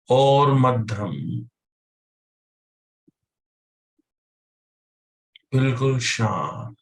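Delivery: slow, measured meditation guide with long pauses; guided meditation. Real, not acted. Teacher guiding listeners to control their breath, calm meditation voice. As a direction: lead them calmly through each breath, drawing out the cues.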